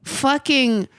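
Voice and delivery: tipsy, rising pitch. Real, not acted. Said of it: "I'm so drunk right now".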